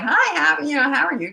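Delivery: chirpy park-bench small talk; friendly greeting. Retold or imitated. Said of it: "So we were like".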